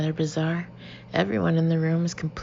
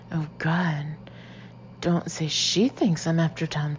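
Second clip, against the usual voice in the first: ordinary delivery, nothing marked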